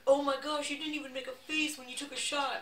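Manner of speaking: high, loud retold astonishment; hammed-up impressed outburst; mock amazement. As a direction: imitate bar guys marvelling loudly.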